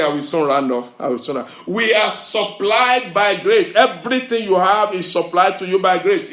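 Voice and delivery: loud, driving sermon voice; full-force preaching. Acted. Preacher builds to the climax before the congregation, very loud. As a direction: preach it out to the whole hall.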